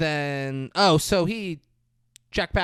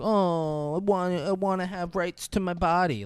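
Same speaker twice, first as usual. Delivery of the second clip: higher-pitched mocking complainer; mocking whine; 6 semitones higher than usual. Host mimics people who want rights to their body, mockingly.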